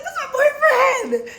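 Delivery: very high, slow, whiny wail; mock crying girlfriend insisting to police. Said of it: "She's like".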